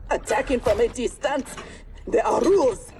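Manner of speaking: loud indignant rebuke; angry. Acted. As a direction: scold him, rising to fury. Heated rebuke during sparring.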